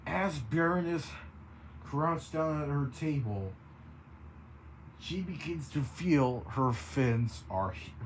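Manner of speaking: plain reading voice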